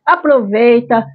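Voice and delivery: bubbly high anime greeting voice; perky kawaii channel host; cute anime streamer. Acted.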